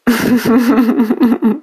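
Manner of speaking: short chuckle at his own pun; a pleased little laugh after the punchline; amused chuckle, tickled by the joke. Real, not acted.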